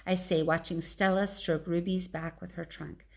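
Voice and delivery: usual speaking voice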